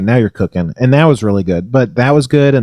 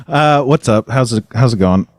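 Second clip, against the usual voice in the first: normal talking voice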